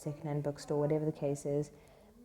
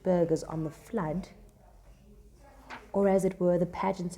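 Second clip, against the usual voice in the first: dramatic reading; 2 semitones higher, 4 dB louder than usual. Acted. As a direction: declaim it with sweeping imagery. Reads Salerio's speech aloud.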